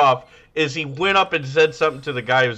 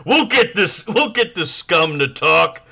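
movie tough guy; 5 semitones higher, 5 dB louder than usual. Acted. Podcaster acting out an interrogation scene, loud tough-guy voice. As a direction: call for your enforcer with mock menace.

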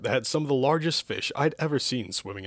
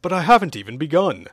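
higher, louder objection; mildly baffled, pitched up; confused protest; 5 semitones higher, 7 dB louder than usual. Acted. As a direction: protest in puzzlement. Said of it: "seemed more confused than offended".

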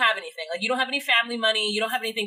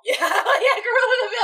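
laughing yell, much higher and louder; excited laughing shout; 10 semitones higher, 6 dB louder, faster than usual. Real, not acted. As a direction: shout the name with delight. Gleeful recognition of villain.

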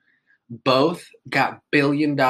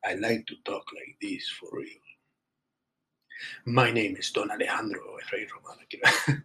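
mock Spanish accent; 5 dB quieter than usual. Host insisting to listeners, still in a mock Spanish accent. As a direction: keep up the fake Spanish accent, half whispered. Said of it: "that is my real accent".

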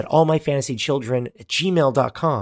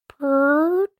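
purring sound. Acted. Box opens, strange sound.

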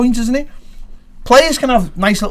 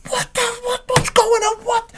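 high, baffled squeal; baffled footballer; 3 dB quieter, slower than usual. Retold or imitated. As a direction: squeal it like a confused player. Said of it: "Instead of going".

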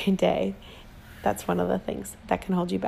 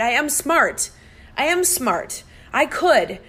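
defiant fight back; 5 semitones higher, slower than usual. Retold or imitated. Wife fighting back against her first husband, defiant. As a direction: push back against his words with force. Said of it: "except to just fight".

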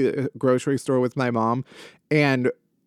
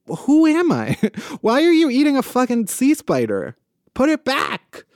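exasperated disbelief; 9 semitones higher than usual. Real, not acted. Mock scolding crab eater.

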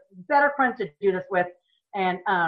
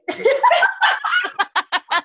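loud, high laugh; high burst of laughter; 4 dB louder than usual. Real, not acted.

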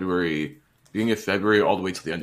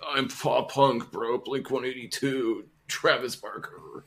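mock pop punk bro; 4 semitones higher, slower than usual. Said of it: "be like".

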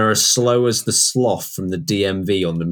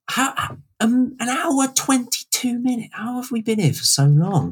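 surprised. Real, not acted. Host startled by the episode length.

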